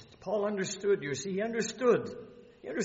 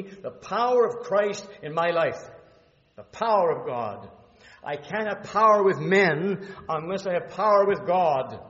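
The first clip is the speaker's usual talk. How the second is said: loud, higher fervent preaching; 3 semitones higher, 9 dB louder than usual. Acted. Preacher pressing the power of Christ on the church, at full force. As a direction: drive it home from the pulpit.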